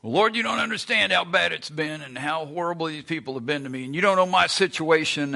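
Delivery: mock self-pitying griping; whiny complaint. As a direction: complain to the Lord like a wronged victim. Complaining believer voiced in sermon.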